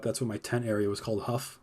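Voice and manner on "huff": ordinary talk, nothing marked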